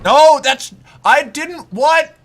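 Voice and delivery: high, loud stunned outburst; shocked. Real, not acted. Streamer shocked by a sudden hit. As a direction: blurt it out in shock.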